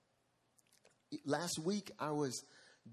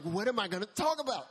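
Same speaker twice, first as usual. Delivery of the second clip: higher and louder, in a rush of panic; a stressed pastor blurting out his panic; panicked cry; 9 semitones higher, 8 dB louder than usual. Retold or imitated. Panicking over the session.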